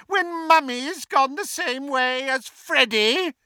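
very high, taunting villainess; mocking taunt. A narrator's reading. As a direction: needle her with a high mocking question. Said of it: "taunted Bellatrix".

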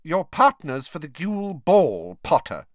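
high, emphatic teacher voice falling on the name; coldly. A narrator's reading. Professor clarifying for a puzzled student, coldly. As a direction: spell it out with cool impatience. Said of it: "she said coldly".

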